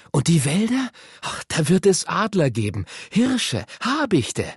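high-pitched, eager anticipation; thrilled would-be explorer, pitch climbing; excited boy. A narrator's reading. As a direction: get carried away listing wonders. Boy thrilled about exploring tomorrow.

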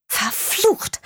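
high, sharp curse; cursing on finding no telephone; frustrated curse. Acted. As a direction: swear in frantic frustration. No phone, she curses.

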